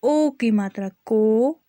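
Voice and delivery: drawn-out syllables, each sounded clearly; slow spelling drill. Shown as a demonstration. Teacher spelling out a sentence for children, slow and clear. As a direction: spell it out slowly for young learners.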